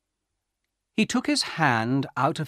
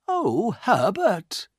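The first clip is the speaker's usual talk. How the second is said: high, drawn-out cry of dismay; worried mother's cry; 10 semitones higher, 4 dB louder, much slower than usual. A narrator's reading. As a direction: cry out slowly, high, afraid for your son. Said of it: "his mother cried".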